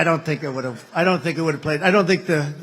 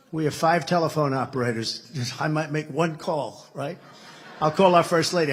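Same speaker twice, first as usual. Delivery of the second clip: ordinary delivery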